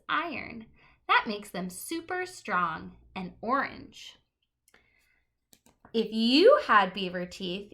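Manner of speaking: lively picture-book read-aloud; reading to children. Acted. Teacher reading a book to the class, lively and clear.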